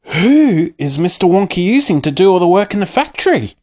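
high, pressing question; eager cry. A narrator's reading. Charlie pressing Grandpa about the workers, eagerly. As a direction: push Grandpa for the answer. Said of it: "cried Charlie".

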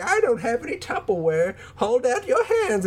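high, sweet granny insisting; old lady voice. Retold or imitated. Imitating Rosie offering meatballs, high old lady voice. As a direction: coax him like a tiny old grandma.

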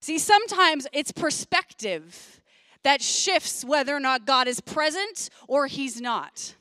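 slow, deliberate sermon delivery; slow preaching. Acted. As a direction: lay each phrase down slowly for the congregation. Slows down to preach the point.

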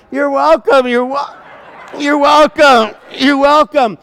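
loud, high repeated exclamation; flustered loud reply. Retold or imitated. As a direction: blurt it loudly, pitched high, laughter breaking in. Flustered reply to gushing thanks.

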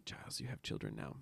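breathy whispered retort, low and fast; whispered aside to Giles. Retold or imitated. Fan whispering a retort at Giles on screen. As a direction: hiss it under your breath at the screen. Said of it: "And I was like".

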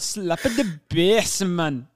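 high, slow, hammed-up Jamaican; drawn-out mock Jamaican catchphrase; mock Jamaican accent. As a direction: parody a failed Irish accent gone Jamaican.

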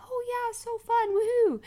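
put-on high, squeaky sarcastic toddler. Mom mimicking her son's fake cheer, high and squeaky. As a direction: mimic a little boy's fake excitement. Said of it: "And he's like".

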